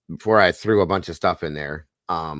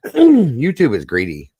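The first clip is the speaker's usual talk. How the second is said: mocking; 7 semitones higher, 7 dB louder, slower than usual. Real, not acted. Complaint said after clearing throat.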